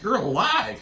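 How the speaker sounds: acted-out stunned realisation; imitated shock. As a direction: react in disbelief, building on the second line. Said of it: "He's shocked".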